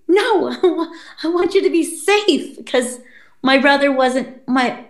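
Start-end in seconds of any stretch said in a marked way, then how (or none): 0.0-2.6 laughing refusal. Retold or imitated. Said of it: "I was like".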